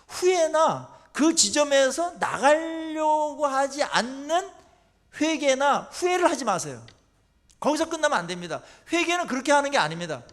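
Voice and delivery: loud, slow, hammering sermon delivery; fervent preaching. Acted. Pastor urging the congregation not to stop at regret, fervent loud preaching. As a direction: preach it out with full force.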